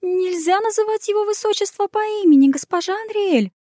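high, frightened pleading maid. A narrator's reading. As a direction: beg her, high and scared. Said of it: "взмолилась она".

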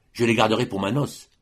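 ordinary delivery

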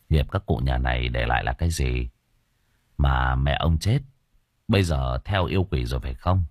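low, probing interrogation; grave exorcist pressing for answers; stern spirit master. Acted.

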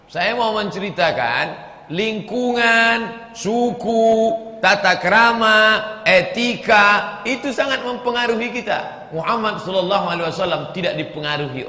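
high, loud sermon build; fiery preaching. Acted. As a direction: drive the point home to the crowd. Preaching at full force.

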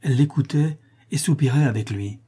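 ordinary delivery, nothing marked

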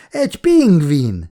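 slow, amused exclamation on a laugh; a touch of relieved laughter. A narrator's reading. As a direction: laugh at the silly surprise. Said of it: "nevetett fel a nő".

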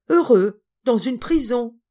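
loud, incredulous exclamation; indignant disbelief. A narrator's reading. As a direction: burst out on the first word, appalled. Indignant cousin argues with boy.

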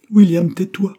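sudden high loud outburst; a girl snapping at her friend to stop; vehement cry. Acted. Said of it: "s'écria-t-elle avec véhémence".

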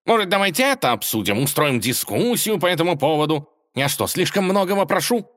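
high, loud heated outburst; heated yelling. Acted. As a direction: shout it in a temper. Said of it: "в запале раскричался он".

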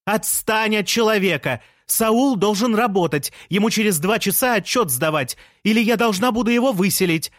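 high, heated order; insistent high-pitched protest; shouted scolding. A narrator's reading. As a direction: yell at him to back off. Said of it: "закричала Лея".

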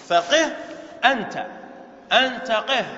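ordinary delivery

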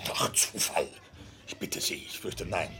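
hushed. Acted. Grim discovery after the beatings.

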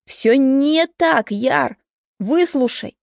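pleading. A narrator's reading. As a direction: beg him to listen, stretching the words. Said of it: "взмолился он".

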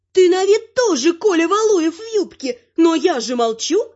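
high-pitched granny comeback; needling grandmother, voice way up; bickering granny. A narrator's reading. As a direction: retort in a high old-lady voice. Granny retorts to troll woman.

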